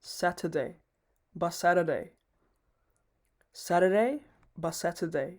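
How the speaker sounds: Saturday said quick, sa-də-day run together; Saturday rattled off fast. Shown as a demonstration. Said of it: "আর একটু fast করে বলতে হবে".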